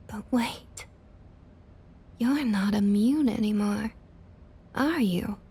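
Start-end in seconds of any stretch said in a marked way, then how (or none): 0.0-0.9 mock gasp. Feigned realisation.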